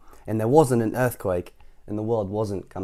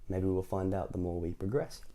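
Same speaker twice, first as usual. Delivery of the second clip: ordinary delivery, nothing marked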